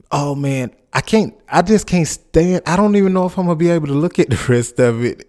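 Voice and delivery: normal talking voice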